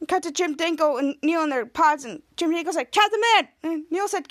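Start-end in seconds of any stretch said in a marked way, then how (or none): 2.9-3.5 high, loud cry for attention; mock calling out. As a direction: call out to a rescuer. Trapped man calls out.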